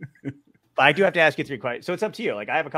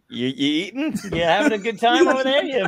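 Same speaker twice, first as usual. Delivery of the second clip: sarcastic teasing, higher and louder; teasing mock question; 9 semitones higher, 5 dB louder than usual. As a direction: needle him for eating. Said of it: "he's like".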